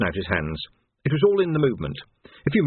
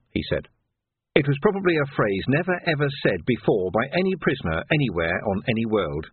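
ordinary delivery

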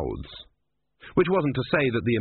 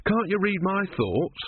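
higher, pleading questions rising in distress; anxious young monk; 6 semitones higher than usual. A narrator's reading. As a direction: beg the god to understand, slowly and anxiously. Brother pleads anxiously with the god.

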